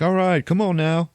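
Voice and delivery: mock DJ call; DJ hype. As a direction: pump up the room. DJ hyping crowd.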